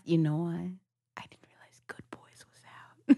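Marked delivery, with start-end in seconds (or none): 1.2-2.6 unvoiced confessing whisper; sheepish whispered admission. Real, not acted. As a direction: let it slip in a whisper. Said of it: "confessional whisper".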